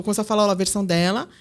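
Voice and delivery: ordinary talk, nothing marked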